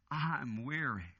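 slow, low, drawn-out sigh of a line; weary and worn out. Acted. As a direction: let it sink, exhausted. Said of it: "He's saying".

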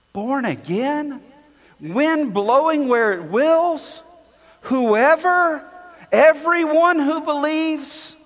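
incredulous questions. Retold or imitated. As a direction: echo his shocked disbelief. Voicing Nicodemus's shocked questions.

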